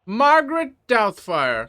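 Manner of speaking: calling out. Acted. Calling witness to the stand.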